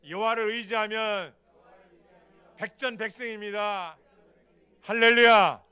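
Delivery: loud fervent preacher's cry; preaching at full force. Acted. Preacher shouting to the congregation, fervent. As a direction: call it out to the congregation.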